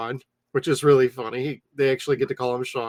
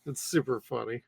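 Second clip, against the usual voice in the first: normal talking voice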